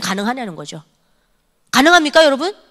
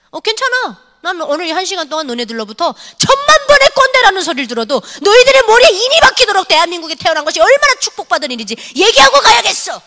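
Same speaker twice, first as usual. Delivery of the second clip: higher, louder unbending retort; defiant teacher; 7 semitones higher, 5 dB louder than usual. Retold or imitated. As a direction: refuse to back down to the teasing kids. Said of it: "저를 놀리더라고요". (놀리는 학생들에게 굽히지 않는 선생님의 높고 큰 대꾸)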